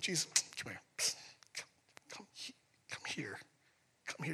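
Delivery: hushed urgent whisper pulling him aside; psst, whisper it close and secret. Retold or imitated. Peter whispering to pull Jesus aside, hushed.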